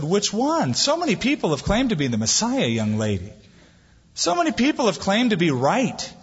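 put-on pitched high, needling the young woman; challenging skeptic. Atheist questioning a young woman's belief, high and challenging. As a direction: poke at her faith with a sceptic's challenge.

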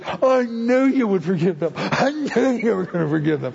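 whiny sulking complaint with a mock sob; mock whine. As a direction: grumble like a sulking child. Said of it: "He says".

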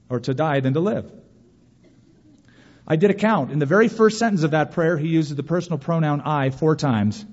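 ordinary delivery

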